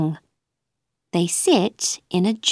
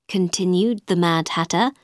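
plain reading voice

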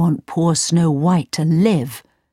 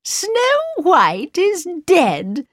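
evil queen; slower than usual. Acted. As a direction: savor every word, triumphant. Wicked queen gloats.

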